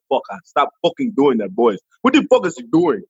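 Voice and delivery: quick mock African accent, annoyed; Michael Blackson. Comedian mimics his friend complaining backstage, annoyed African accent. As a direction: scold in a put-on African accent. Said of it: "But behind, he was".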